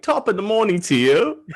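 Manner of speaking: mock Irish greeting. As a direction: blurt an Irish greeting by accident. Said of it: "you're like".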